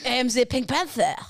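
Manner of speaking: put-on bumbling Pink Panther-style French accent. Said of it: "This is good".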